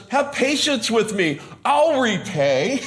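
high, slow pleading plea; hammed-up desperate debtor begging; pleading slave. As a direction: beg for patience, voice lifted and drawn out. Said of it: "when he says".

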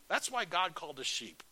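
ordinary talk, nothing marked

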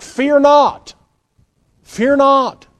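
high, loud, ringing command; commanding proclamation. Acted. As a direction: proclaim it as Jesus crying out. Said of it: "saying things like".

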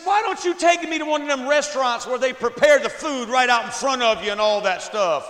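high, loud mimic of the wife. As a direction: squeak it out like his wife. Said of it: "she said, she said".

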